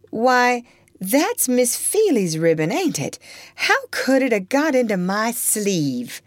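a hint of a sly girl, innocent surprise; lightly voiced girl. Topsy's voice.

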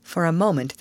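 ordinary delivery

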